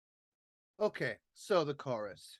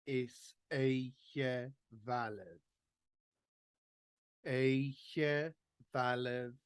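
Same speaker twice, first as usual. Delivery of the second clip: every Gaelic word drawn out slowly for the class to follow; slow read-along; much slower than usual. Shown as a demonstration. Tutor reading the verse slowly while learners read along. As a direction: read it very slowly so they can keep up. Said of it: "I'll read this two again, and you read it through with me".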